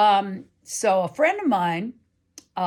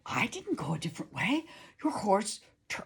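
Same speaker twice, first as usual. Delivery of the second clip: softer, half-whispered denial; hushed protest; 6 dB quieter than usual. Retold or imitated. [name] answering her friend, hushed and stunned. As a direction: murmur it in disbelief. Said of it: "I said".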